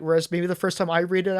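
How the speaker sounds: ordinary delivery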